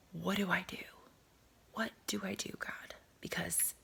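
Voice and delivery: whispered plea to God, barely voiced; hushed prayer. Woman on her couch whispering a plea to God. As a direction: breathe the words out as a private prayer.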